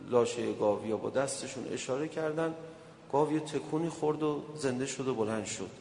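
ordinary delivery

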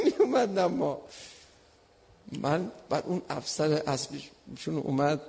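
ordinary talk

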